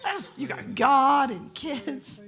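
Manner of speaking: slow, laughing disbelief at the idea. Retold or imitated. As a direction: laugh it off slowly as too much. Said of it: "and he goes".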